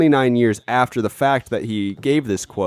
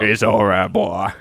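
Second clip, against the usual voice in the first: lightly voiced cowboy impression; Arthur Morgan; 3 semitones lower than usual. Outlaw reassuring his horse, lightly put-on cowboy voice. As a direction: echo the soothing outlaw voice. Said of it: "That was a good Arthur Morgan".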